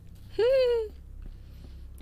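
short high-pitched laugh; a bright squeal of a laugh. Real, not acted.

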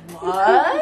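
stunned, laughing what; a loud disbelieving what; shocked what. Retold or imitated. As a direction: hit in the head, stunned. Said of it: "I said".

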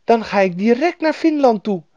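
put-on high, loud eager girl; happy cry. A narrator's reading. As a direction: call it out joyfully as a child. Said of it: "riep Gerda blij".

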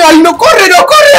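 high loud frantic urging; panicked urging. Real, not acted. Gamer fleeing the monster, high frantic yells. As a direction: scream at yourself to run.

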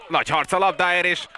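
high, loud live call; rising excitement over the play; excited sports commentary. Real, not acted.

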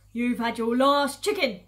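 loud, slow, heavy warning; yelled threat. Acted. Farmer Boggis to the chicken thief, yelling. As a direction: bellow it slowly at the thief. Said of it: "yelled Boggis".

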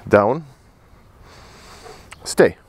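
ordinary talk, nothing marked

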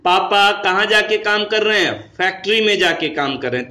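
ordinary talk

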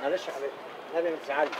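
soft, low and tender; soothing. Acted. Husband consoling after the tyre was hit, soft tender. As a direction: coo comfort over the bike.

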